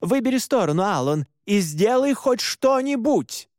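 high, exasperated demand; barked order. A narrator's reading. Mora urges Allan to act, exasperated bark. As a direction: push for action, pitch well up. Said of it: "рявкнула она".

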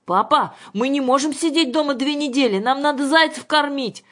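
put-on high, loud childlike protest; girl shouting. A narrator's reading. Daughter protests to father from upstairs, shouting. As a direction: cry out urgently to someone downstairs. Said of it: "закричала с площадки второго этажа Юлька".

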